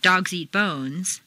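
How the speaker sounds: slow, with Dogs and bones hit and eat lighter; noun stress. Shown as a demonstration. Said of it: "two sentences with strong intonation".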